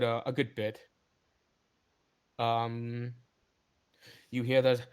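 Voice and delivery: normal talking voice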